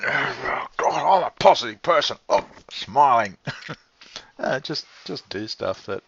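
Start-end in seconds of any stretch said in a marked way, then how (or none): 0.0-3.4 loud, hammed-up positive person; mock pumped-up positivity. Mocking how much effort positivity takes, loud mock pep. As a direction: pump it up in mock self-cheerleading. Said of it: "Do you have to pump on".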